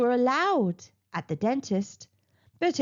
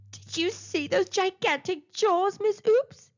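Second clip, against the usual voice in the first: mild exclaiming; 8 semitones higher than usual. Acted. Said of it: "he exclaimed".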